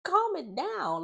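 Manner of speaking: high, slow, drawn-out telling-off; exasperated. Retold or imitated. Vlogger telling Huda off, high slow exasperated plea. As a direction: stretch it out like you're fed up with her. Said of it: "I was just like".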